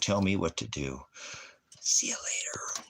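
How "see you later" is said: soft whispered goodbye. Real, not acted. Streamer dismissing a viewer, whisper. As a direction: breathe the farewell with no voice.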